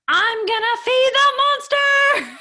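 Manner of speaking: put-on high, loud shrieking little girl; yelling child. Mother imitating her daughter's defiant bedtime yell. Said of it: "like, yelling and she's like".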